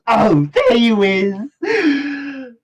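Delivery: high, loud, drawn-out villain call; villain spotting prey. Acted. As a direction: sing out slowly as the villain finding a victim. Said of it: "looking at Calliope and goes".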